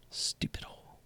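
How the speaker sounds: unvoiced, muttered stupid hole; whispered. Real, not acted. Streamer cursing the losing trade hole, whispered. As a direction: hiss it under your breath.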